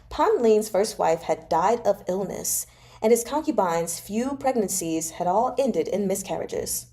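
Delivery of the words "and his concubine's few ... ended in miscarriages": usual speaking voice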